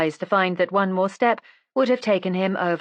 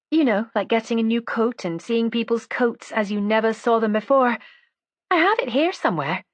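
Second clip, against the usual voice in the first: higher-pitched woman's character voice; chatty Miss Dinmont figure; 5 semitones higher than usual. Acted. Miss Dinmont tells her friend about the cousin's brooch, idle chatter.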